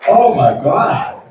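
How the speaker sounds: normal talking voice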